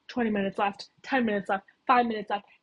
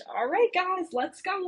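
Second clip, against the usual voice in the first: high, upbeat team rally; acted pep-talk pitched up high; rallying call; 8 semitones higher, slower than usual. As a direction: call the crew to get moving. Lupin rallies the team.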